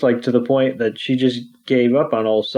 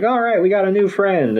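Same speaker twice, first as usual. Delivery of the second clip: higher-pitched mock celebration; retold bouncy cheer; 8 semitones higher than usual. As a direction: cheer lightly, imitating excited bouncing friends. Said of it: "they're bouncing around like".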